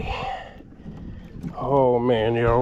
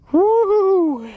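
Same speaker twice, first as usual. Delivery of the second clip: high, louder woo-hoo cheer; whooping celebration; 4 dB louder than usual. Real, not acted. Angler celebrating a monster blue cat, whooping cheer. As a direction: cheer the victory out loud.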